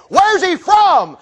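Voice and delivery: high, drawn-out question; eager questioning. Retold or imitated. Pilate looking for someone else to decide, eager questioning. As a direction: pounce on a way out.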